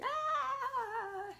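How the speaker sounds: high, loud, dramatic wail imitating the daughter. [name] crying in a mock dramatic wail. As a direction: throw out a theatrical child's cry. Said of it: "you know she loves a drama".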